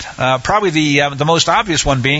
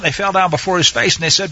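ordinary talk

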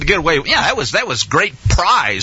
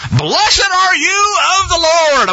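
grand greeting; 10 semitones higher, slower than usual. Acted. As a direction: boom it out like a proud king. Saul greets Samuel grandly.

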